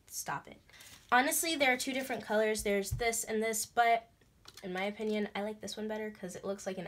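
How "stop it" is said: whispered self-scold. Real, not acted.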